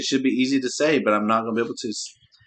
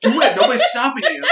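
laughing shout; 13 semitones higher, 6 dB louder than usual. Real, not acted. Playful shouting match.

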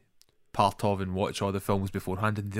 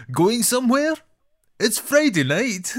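film character parody; 13 semitones higher, 6 dB louder than usual. Retold or imitated. Imitating the guy at the film's beginning, overdramatic. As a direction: stretch the lines out in a silly high voice. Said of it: "the guy at the beginning".

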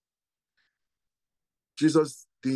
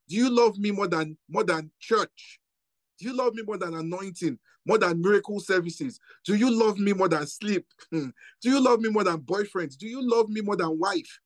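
preaching climax; 6 semitones higher than usual. Real, not acted.

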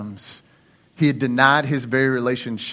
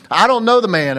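louder, higher acted denial; emphatic denial; 6 semitones higher, 5 dB louder than usual. Peter answering his accusers at the trial, emphatic denial. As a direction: deny it firmly, landing hard. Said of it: "Peter said".

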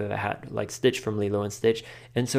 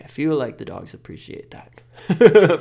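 playful put-on voice; 5 semitones higher, 9 dB louder, slower than usual. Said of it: "And I'm like".

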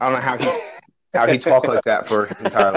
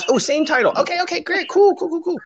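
high, mock-chirpy condescending voice; a patronizing wedding guest; fake polite acquaintance.

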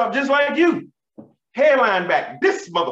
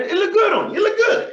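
reassuring hype; 13 semitones higher than usual. Retold or imitated. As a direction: pump him up excitedly. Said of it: "He was like".

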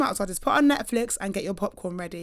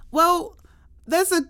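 daughter's voice; 5 semitones higher, 5 dB louder than usual. Retold or imitated. Mother voicing her daughter's serious announcement.

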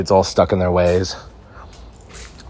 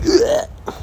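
mock disgust. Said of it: "They're like".

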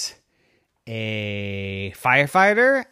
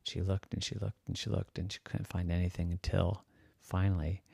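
much softer, low secretive telling; hushed narration; 7 semitones lower, 14 dB quieter than usual. A narrator's reading. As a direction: hush it down, sneaking. Narration drops to hush.

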